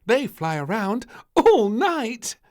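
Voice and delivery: put-on high, loud little girl's protest; a small child arguing back; little princess. Little Princess arguing with the maid, high and loud. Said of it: "said little Princess".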